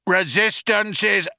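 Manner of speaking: high, theatrical bellow. Acted. Vogon guard showing off his shouting, bellowing. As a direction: bellow it like a slogan. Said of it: "He filled his lungs and bellowed".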